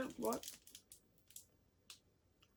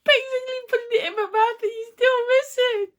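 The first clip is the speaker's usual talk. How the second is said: high, loud, laughing; 10 semitones higher, 6 dB louder than usual. Real, not acted. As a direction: laugh while complaining. Throwing game.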